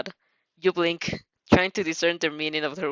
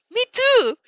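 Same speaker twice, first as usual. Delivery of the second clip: high, bright burst of joy; excited squeal; 6 dB louder than usual. Acted. Friend joining in, excited and high. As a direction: squeal it out happily.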